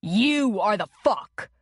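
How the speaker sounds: nasal retort. A narrator's reading. Man with a broken nose snapping back, nasal retort. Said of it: "Connor returned nasally".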